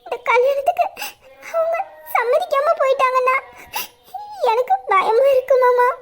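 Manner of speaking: frightened and tearful, apologizing; crying apology. Acted. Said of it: "எதுக்குடி அழுகுற". (அழுதுகொண்டே பயத்துடன் பேசுதல்)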